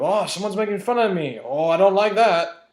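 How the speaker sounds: high, loud mock-offended whine building to a wail; mock whining dictator. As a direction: play a ridiculously wounded tyrant, rising into fake crying. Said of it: "what else is he gonna do?".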